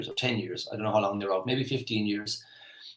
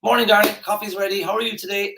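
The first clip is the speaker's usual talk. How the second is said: bright-eyed morning hello, pitched way up; cheery greeting; 10 semitones higher, 5 dB louder than usual. Acted. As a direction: greet your darling with high, exaggerated cheer. Models a cheery greeting to wife.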